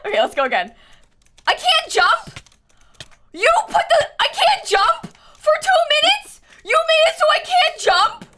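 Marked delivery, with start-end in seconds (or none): 1.5-8.2 high, loud incredulous protest; outraged disbelief. Real, not acted.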